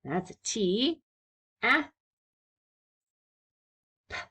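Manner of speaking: ordinary delivery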